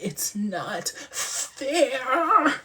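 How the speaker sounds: high, very slow, crying whine; Margot whining. Retold or imitated. Imitating Margot throwing a tantrum, crying. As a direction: stretch it out like a pouting cry. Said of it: "Margot got mad and she was like".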